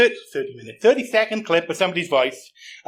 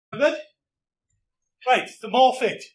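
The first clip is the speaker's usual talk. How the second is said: ordinary talk, nothing marked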